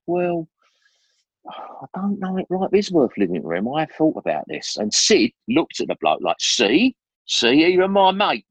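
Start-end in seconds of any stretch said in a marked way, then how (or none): 0.1-4.8 high, mock-solemn musing; pitched-up pretend despair, played for a laugh; mock gloomy reply. Young John telling the psychiatrist life isn't worth living, mock gloomy. 6.4-8.5 high and louder told-you-so; triumphant see. Retold or imitated. Sid pointing the psychiatrist to his mate, mock triumphant.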